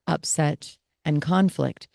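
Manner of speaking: ordinary talk, nothing marked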